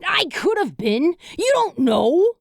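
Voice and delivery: high squeaky protest. A narrator's reading. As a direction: squeak it out indignantly. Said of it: "Scrappy squeaked".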